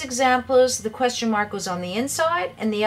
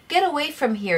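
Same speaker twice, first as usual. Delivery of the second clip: mock yell, pitched up high; raised-pitch shout of get away; yelled command; 6 semitones higher than usual. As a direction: bark it out like an angry kid. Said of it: "yelled Jimmy".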